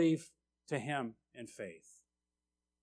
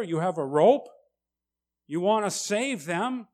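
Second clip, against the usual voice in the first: usual speaking voice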